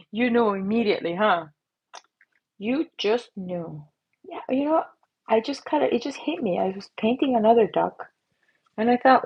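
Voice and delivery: ordinary talk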